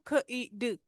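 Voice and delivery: ख, ई, ड as separate sounds, ई brief; short E, sounded out. Shown as a demonstration. Teacher breaking kid into sounds, short ई. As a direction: spell out by sound, keeping ई short. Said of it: "short ई आ रहा है ना".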